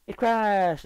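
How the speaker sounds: high, loud startled cry; surprised outburst. Real, not acted. Gamer reacting as his game crashes, loud surprised shout. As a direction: blurt it out as the game dies.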